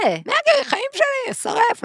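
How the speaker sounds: very high squealed adoration; mock hysterical fangirl squeal; imitated fan screaming. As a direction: gush like a screaming concert fan. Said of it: "צרחות, צרחות".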